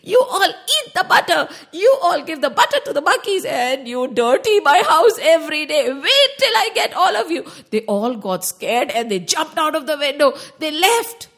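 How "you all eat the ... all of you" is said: loud, higher hammed-up scolding; angry auntie shout. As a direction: bellow at the naughty kids, half laughing. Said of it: "very angry, came charging".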